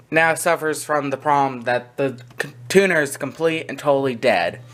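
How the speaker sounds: ordinary talk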